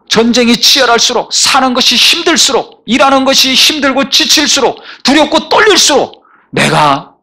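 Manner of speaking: mounting sermon fervour; preaching at full force. Acted. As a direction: climb toward the altar call. Rising sermon climax.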